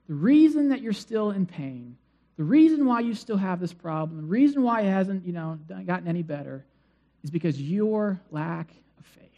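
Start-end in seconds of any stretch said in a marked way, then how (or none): none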